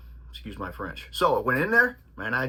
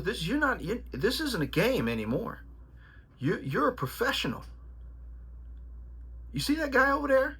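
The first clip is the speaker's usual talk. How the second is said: put-on much higher, stern old trainer voice; imitating his trainer; 10 semitones higher than usual. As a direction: channel the old-school trainer laying down the law. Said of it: "He goes".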